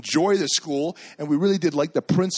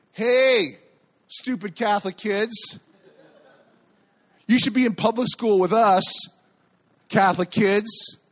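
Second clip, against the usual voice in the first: higher, louder schoolyard jeer; mocking kids; 7 semitones higher, 4 dB louder than usual. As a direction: taunt like a bully calling across the street. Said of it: "they start mocking them".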